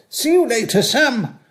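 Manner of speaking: breezy carer goodbye. Retold or imitated. Old man mimicking carers leaving their shift. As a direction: imitate the cheery staff sign-off. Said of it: "Care staff going off shift would say".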